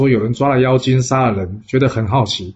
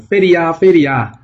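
high and loud, crying out again and again; shouting for help; 5 semitones higher, 5 dB louder than usual. A narrator's reading. Pipa spirit keeps shouting to the gathering crowd, loud high cry. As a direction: keep yelling to rile the crowd. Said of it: "继续喊着".

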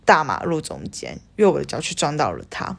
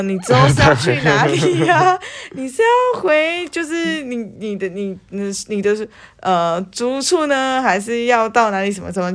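rambling friend; 5 semitones higher than usual. Retold or imitated. Mocking rambling friend's questions.

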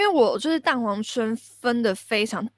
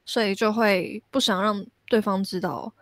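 ordinary talk

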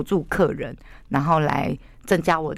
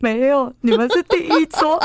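higher, louder flustered voice; high flustered reply; 9 semitones higher, 4 dB louder than usual. Retold or imitated. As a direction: answer sheepishly, voice up high. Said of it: "然后我就说".

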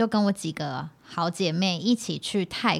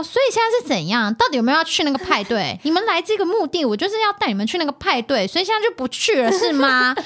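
higher, louder heated arguing; angry arguing; 6 semitones higher, 3 dB louder than usual. Retold or imitated. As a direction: argue like a fed-up friend. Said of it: "就是大吵".

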